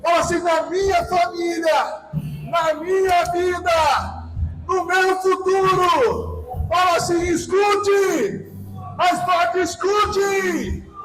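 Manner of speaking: slow, high-energy preaching in short bursts; forceful shouted declaration. Real, not acted. Preacher leading the congregation against the enemy, forceful shouted declaration. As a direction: hurl each phrase out and hold its ending.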